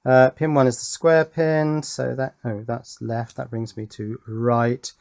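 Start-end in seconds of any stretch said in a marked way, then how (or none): none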